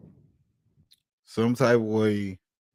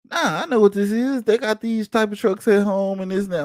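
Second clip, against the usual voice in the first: mock quote; 8 semitones higher, 5 dB louder than usual. Wife brushing off police warning.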